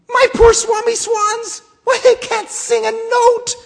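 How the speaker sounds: high, loud, croaking protest; croaking Lorax cry. Acted. Lorax scolding the Onceler about smog, high croaking shout. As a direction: wail at him in a high croak for the swans. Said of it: "he cried with a cruffulous croak".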